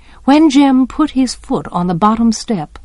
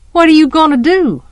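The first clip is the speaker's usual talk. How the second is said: higher-pitched, demanding question; suspicious challenge; 7 semitones higher, 4 dB louder, faster than usual. A narrator's reading. Scout questioning the boys' plan, suspicious challenge. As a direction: challenge them on what they're up to.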